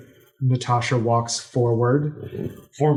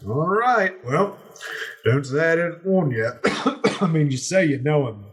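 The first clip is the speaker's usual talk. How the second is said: wary guard; 6 semitones higher than usual. Acted. Guard warns the party.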